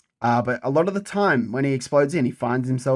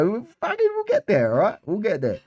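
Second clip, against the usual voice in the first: put-on Scouse accent; 8 semitones higher than usual. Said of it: "Gotta work on my fucking Scouse accent".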